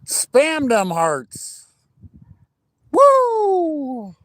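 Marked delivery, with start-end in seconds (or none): 2.9-4.1 excited whoop. Real, not acted. Excited cheer on stream.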